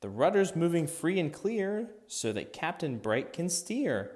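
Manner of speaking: ordinary delivery, nothing marked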